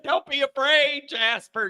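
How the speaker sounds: slow, high coaxing voice; mock reassurance. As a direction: coax him gently, stretched out and high. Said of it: "He said".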